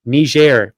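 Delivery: Niger said nee-ZHAIR; Niger stressed on the last syllable, nee-ZHAIR; French-style pronunciation. Shown as a demonstration. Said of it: "it's pronounced Niger".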